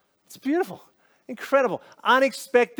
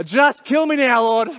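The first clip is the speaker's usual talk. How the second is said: higher and louder, a furious dramatic outburst; angry demand; 4 semitones higher, 6 dB louder than usual. Retold or imitated. Jonah furious at God's mercy, loud dramatic outburst. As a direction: throw it at God like a tantrum. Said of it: "He's angry with God".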